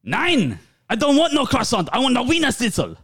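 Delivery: high, indignant mock German; German accent.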